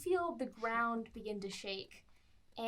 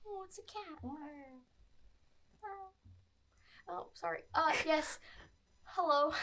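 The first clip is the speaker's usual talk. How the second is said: high timid giant; 5 semitones higher than usual. Acted. Giant greets the cat.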